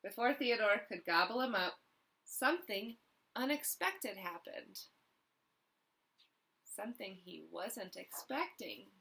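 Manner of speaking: ordinary delivery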